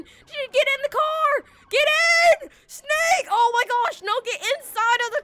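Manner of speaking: high, desperate shout of a name; frantic call. Real, not acted. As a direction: yell for him to hurry up. Calling friend into car during chase.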